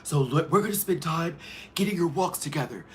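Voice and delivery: high, bright runway coach voice; Stacy impression. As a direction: play an upbeat runway coach. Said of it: "Stacy's like".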